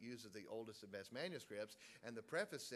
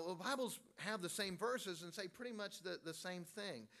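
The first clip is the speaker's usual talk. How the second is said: higher-pitched imitated objector; mock objection; 7 semitones higher, 3 dB louder than usual. Preacher imitating someone defending all Bibles, mock objection. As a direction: voice the naive claim before refuting it. Voices objector, then "no, they don't.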